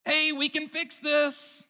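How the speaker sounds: high, drawn-out cheery fixer voice; Joy. Retold or imitated. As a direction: imitate a chirpy character, slow and high. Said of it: "Did you hear the first word she said".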